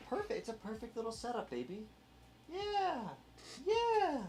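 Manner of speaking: high, soft cooing at the dog. Real, not acted. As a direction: coo sweetly to your puppy. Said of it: "I have to baby her".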